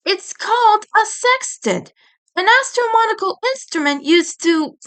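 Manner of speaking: high, fussy know-it-all voice; prim scolding boy. Acted. Edd chiding friends for roughhousing, prim and high. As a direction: scold them like a prissy schoolboy. Edd explaining his device.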